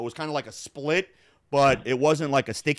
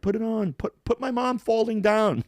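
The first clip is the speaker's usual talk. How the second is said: a bit higher, eager nagging; pestering; 9 semitones higher than usual. Retold or imitated. Tatiana urges him to post the video, light eager nagging. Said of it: "Tatiana's like".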